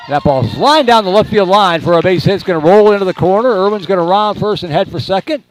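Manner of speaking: fast, high, loud hit call; excited play-by-play. Acted.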